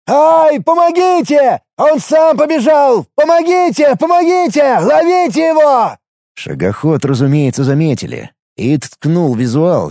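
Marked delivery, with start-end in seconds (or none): 0.1-6.0 high, loud frantic screaming; yelling for help. A narrator's reading. Calling guards as the walker runs away, yelling for help. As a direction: shriek for help at full voice. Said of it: "заорал он, что есть мочи".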